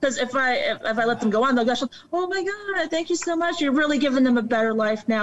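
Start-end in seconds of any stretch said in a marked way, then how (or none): 2.1-5.2 very high, effusive start easing down; mock gushing thanks. Stranger thanking a greyhound owner for saving dogs, mock gushing. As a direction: imitate an overcome admirer, starting pitched way up. Voices people gushing over rescue.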